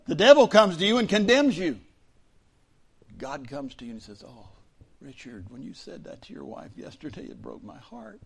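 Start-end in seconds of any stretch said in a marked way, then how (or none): 4.3-8.2 sweet silent voice. Acted. God's voice softly correcting the pastor in his heart. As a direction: ask it tenderly, almost under the breath. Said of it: "that sweet, silent voice".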